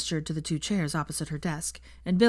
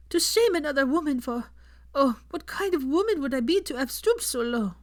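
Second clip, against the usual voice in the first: higher, tearful French-tinged apology; Fleur apologizing; 8 semitones higher than usual. A narrator's reading. Fleur confesses her cruelty to Hermione, high and tearful. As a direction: plead for forgiveness.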